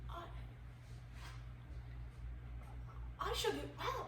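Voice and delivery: hushed wonder. Acted. Colin's dawning hope in garden.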